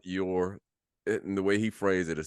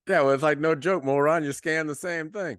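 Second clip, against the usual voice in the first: higher, louder scornful jab at the cashier; mocking retort; 9 semitones higher, 5 dB louder than usual. Co-host mocking the clueless cashier, scornful and raised. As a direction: sneer it like you're talking to him.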